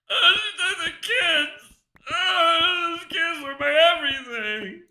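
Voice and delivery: high, loud, slow wailing sob; mock-wail like a heartbroken dad; fake crying. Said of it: "me pretending to cry".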